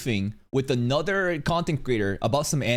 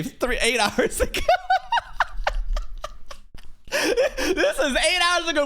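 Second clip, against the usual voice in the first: laughing; slower than usual. Real, not acted. Laughing in disbelief at upload time.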